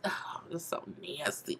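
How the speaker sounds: a quiet grossed-out aside; disgusted whisper. Real, not acted.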